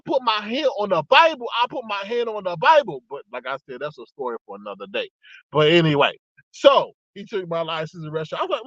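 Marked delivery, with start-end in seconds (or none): 3.1-5.1 dropped aside. Real, not acted.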